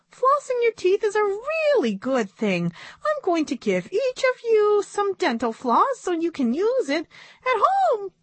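very high squeaky puppet voice; high puppet-show monkey. A narrator's reading. Puppet monkey explains flossing.

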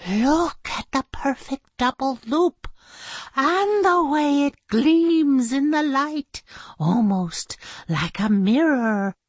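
high, awestruck admiration; marveling. Acted. Teacher holding up a paperclip, marveling. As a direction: swell in wonder, then ease down. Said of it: "she marveled at the magnificent metal masterpiece".

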